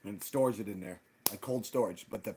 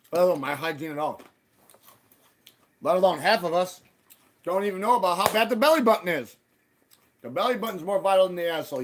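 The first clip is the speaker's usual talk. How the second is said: loud rant; 5 semitones higher, 7 dB louder than usual. Real, not acted. Ranting about belly buttons.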